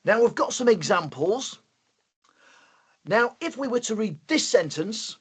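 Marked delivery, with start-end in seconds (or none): none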